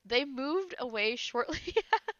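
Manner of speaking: reading while breaking into laughter; laughing through it. Real, not acted. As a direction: giggle through the line. Laughing at the ending.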